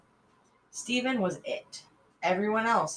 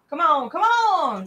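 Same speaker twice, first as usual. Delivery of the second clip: high, loud, slow pleading; boy begging to be given it, impatient; 10 semitones higher, 9 dB louder than usual. Acted. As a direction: whine come on impatiently. Impatient urging.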